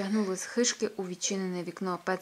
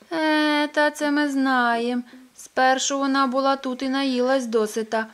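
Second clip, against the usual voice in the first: put-on old Pettson; 6 semitones higher, 9 dB louder, slower than usual. Pettson's character voice.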